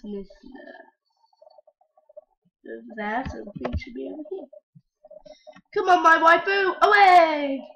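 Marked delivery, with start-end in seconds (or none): 5.7-7.6 loud, high "come on"; loud urging. Acted. Kidnapper urging his crush along, loud. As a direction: hurry her away.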